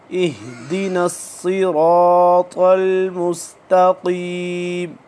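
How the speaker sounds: सिरातल with thick स, र, त, slow; heavy full recitation. Shown as a demonstration. Teacher models सिरातल मुस्तकीम for students, full heavy letters. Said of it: "मोटे पुर पढ़े जाएंगे".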